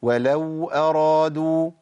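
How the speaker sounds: "ولو أرادوا" with the waw of ولو flowing briefly, slow; correct flowing waw. Shown as a demonstration. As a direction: let the sound run a little on the waw of "ولو". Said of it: "لازم يجري الصوت شوية".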